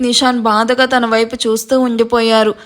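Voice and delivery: ordinary delivery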